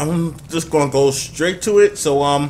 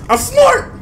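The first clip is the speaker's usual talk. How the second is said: very high, loud gloating; excited bragging; 6 dB louder than usual. Real, not acted. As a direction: crow over your own clever move. Boasting excitedly at game success.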